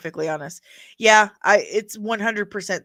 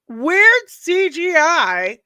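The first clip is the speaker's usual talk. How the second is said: loud, high, drawn-out mockery; mocking echo; 8 semitones higher, 8 dB louder, much slower than usual. Vlogger scoffing at a critic's CGI complaint, mocking echo. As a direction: throw the claim back, slow and high.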